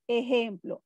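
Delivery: usual speaking voice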